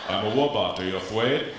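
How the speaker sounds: mock Austrian robot lisping R into W; Arnold with no R's. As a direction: say "I'm a wobot, awe you afwaid" swapping R for W. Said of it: "can't pronounce his own R's".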